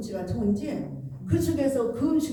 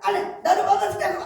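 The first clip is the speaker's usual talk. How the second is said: higher, sharp refusal from the family; snapping back; 7 semitones higher, 3 dB louder, faster than usual. Retold or imitated. Family member curtly refusing to help with the computer, snapping. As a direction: snap the refusal back like a fed-up child. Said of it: "얼마나 쏘아붙이는지".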